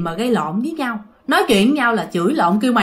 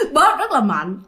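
laughing; 7 semitones higher than usual. Real, not acted. Teller breaks into laughter.

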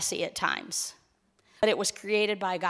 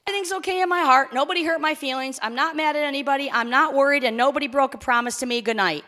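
put-on quick, higher child's rattle-off; blurted out; 4 semitones higher, 5 dB louder, faster than usual. Daughter heading off dad's bedtime questions, blurted out. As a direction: reel it off in one fast breath, imitating a daughter. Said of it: "she blurted out".

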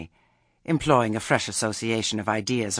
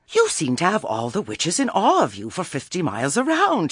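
higher, louder patronizing advice; condescending Lettice; 9 semitones higher, 6 dB louder than usual. Acted. Lettice advising Granny to be nicer, condescending. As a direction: lecture sweetly, as a so-called friend.

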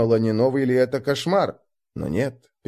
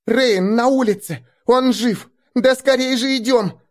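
excited; 11 semitones higher, 4 dB louder than usual. Acted. Girl shouts brother is alive.

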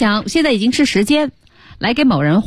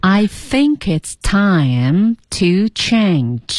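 very slow, careful "I think it's time to change"; slow practice reading; much slower than usual. Shown as a demonstration. Host repeating after the teacher, slow and deliberate. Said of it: "这句话怎么一起来读呢".

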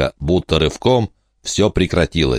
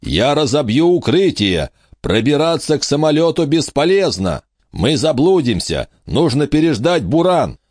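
raised voice straining above a gale; shouting over wind; 9 semitones higher than usual. A narrator's reading. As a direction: call out over the storm, higher and urgent. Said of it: "закричал сквозь ветер".

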